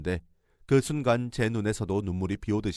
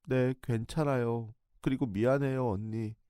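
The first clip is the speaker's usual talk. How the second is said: tearful reply; 2 semitones higher, 4 dB quieter, slower than usual. Retold or imitated. Shaken girl answers tearfully.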